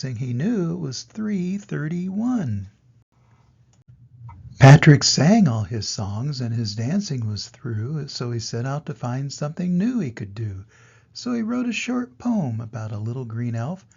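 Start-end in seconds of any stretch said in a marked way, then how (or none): none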